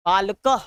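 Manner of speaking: बालकः ending in "-kah"; visarga pronunciation. Shown as a demonstration. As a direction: set बालकः apart, closing on h. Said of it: "ऐसे करके".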